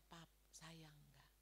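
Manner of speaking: very soft, low and slow; retold timid question. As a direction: ask your husband in a small, hushed, drawn-out voice. Retelling timid question to husband.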